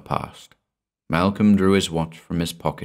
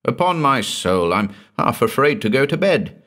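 hearty exclamation, pitched up; lively, higher-pitched confession; 6 semitones higher than usual. A narrator's reading. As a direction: declare it with mild heartiness. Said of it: "said Malcolm heartily".

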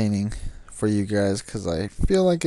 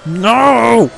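high furious shout of No; angry yell; 9 semitones higher, 7 dB louder, much slower than usual. Real, not acted. Gamer yelling after a crash in the race. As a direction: yell in frustration.

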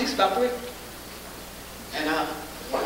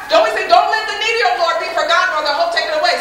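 1 raised, higher plea; 8 semitones higher, 6 dB louder than usual. Retold or imitated. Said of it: "Father, is that right?".

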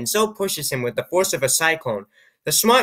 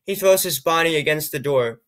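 plain reading voice

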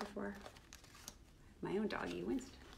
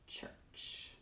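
whisper; 14 dB quieter than usual. Acted. Whispered end of author name.

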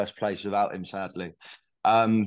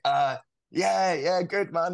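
flustered and higher, star-struck on the phone; freaking out; 7 semitones higher, slower than usual. Retold or imitated. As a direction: stammer a nervous starstruck yes. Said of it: "like, freaking out".

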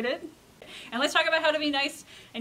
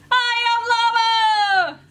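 high, loud, drawn-out holler as a llama; Llama character voice; 11 semitones higher, 11 dB louder, much slower than usual. A narrator's reading. Llama introduces himself to Alpaca, high loud character voice. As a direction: belt it out slowly, way up high. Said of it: "said Llama".

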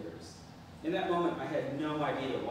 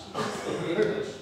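"English" said louder, voice lifting on it; voice raised on English; 5 semitones higher, 6 dB louder than usual. Retold or imitated. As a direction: lean a little harder on "English". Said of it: "I raised my voice a bit on the word English".

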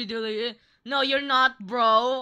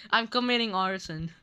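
normal talking voice

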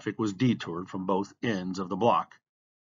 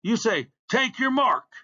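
yelled correction; 8 semitones higher, 4 dB louder, slower than usual. A narrator's reading. Kid in the crowd correcting the starter, yelled. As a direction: heckle from the crowd, rising high. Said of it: "someone in the crowd yelled".

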